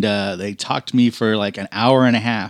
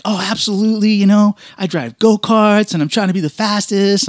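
put-on eager girl; 9 semitones higher than usual. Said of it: "she's like".

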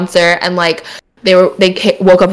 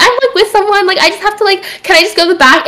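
high-pitched, halting retold plea; high, unsure little voice; mock nervous; 10 semitones higher than usual. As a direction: act out flustered excuse-making at the door. Acts out pleading with doorman.

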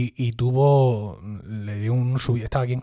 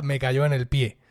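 normal talking voice

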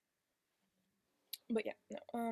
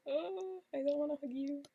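higher, louder groan of refusal; exaggerated reluctance; 4 semitones higher, 7 dB louder than usual. Turning down a friend's hug, exaggerated reluctance. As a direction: recoil from the hug with a loud whine.

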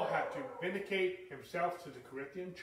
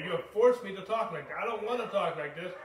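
paraphrasing Paul; 4 semitones higher, 8 dB louder than usual. Retold or imitated. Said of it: "and I'm paraphrasing".

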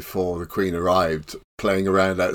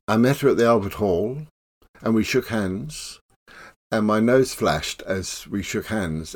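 ordinary talk

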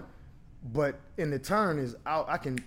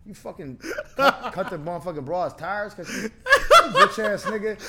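yelling through laughter, higher and louder at the end; laughing mock outrage; 5 semitones higher, 9 dB louder than usual. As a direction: play a furious dude but keep cracking up. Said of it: "A dude is like".

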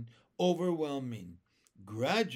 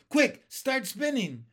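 higher, louder urgent command; urgent yell; 8 semitones higher, 8 dB louder than usual. A narrator's reading. James urges the silkworm to spin, a high loud yell. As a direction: hurry her up, there's no time. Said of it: "yelled James".

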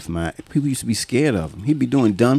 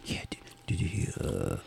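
hushed nosy coworker whisper; gossipy whisper; 7 semitones lower, 7 dB quieter than usual. Retold or imitated. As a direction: whisper the gossip like it's a secret.

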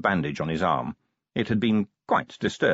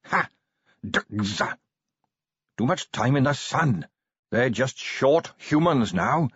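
higher-pitched, emphatic dwarf voice; conspiring dwarf, stern; 7 semitones higher than usual. Acted. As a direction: lay down the law in a secret dwarf meeting. Voices dwarfs plotting in cellar.

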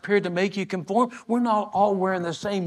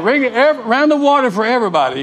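calling louder to the waiter for water; hearty loud call for water; loud retold order; 6 semitones higher, 11 dB louder than usual. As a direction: announce it loud across the table. Man ordering water for the table.

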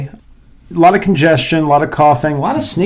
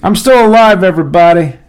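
loud, high shout to everyone; loud announcement; 5 semitones higher, 7 dB louder than usual. Real, not acted. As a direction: call it out loud. Announcing to listeners.

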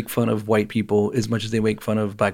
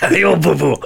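higher mock Japanese accent; 5 semitones higher, 4 dB louder than usual.

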